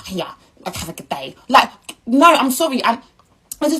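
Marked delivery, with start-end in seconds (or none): 0.1-1.4 mock uninterested cashier, much softer and lower; imitating a cashier who can't be bothered; dismissive mumble. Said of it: "your face is like this".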